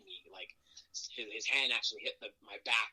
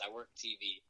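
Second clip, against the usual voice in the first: usual speaking voice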